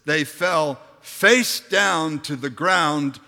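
normal talking voice

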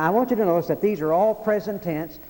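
ordinary delivery

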